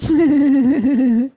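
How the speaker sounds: loud, high laugh in place of birdsong; high mocking cackle. Birds just going.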